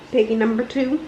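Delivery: plain reading voice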